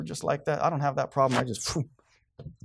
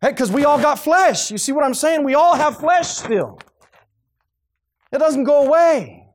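loud, high-pitched pulpit cry; forceful preaching; 12 semitones higher, 8 dB louder than usual. Real, not acted. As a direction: drive the point home at full force. Preacher drives his point home.

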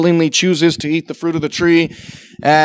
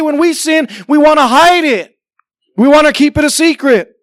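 high, loud full-force preaching; 8 semitones higher, 4 dB louder than usual. Acted. Pastor warning the congregation, loud preaching. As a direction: drive it home from the pulpit.

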